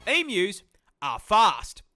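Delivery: usual speaking voice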